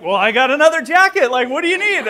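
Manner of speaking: loud, high-pitched incredulous offer; retold exasperation. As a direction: plead with a helpless complainer, voice pushed up. Exasperated offers to complaining climber.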